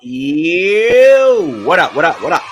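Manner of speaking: high, loud shout-out; hype greeting. Real, not acted. Cohost greets listeners, hyped and loud. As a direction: greet the show like a crowd.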